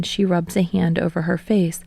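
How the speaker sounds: usual speaking voice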